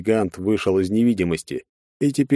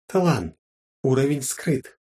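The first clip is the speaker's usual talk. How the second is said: system voice; 6 semitones higher, slower than usual. A narrator's reading. System message readout.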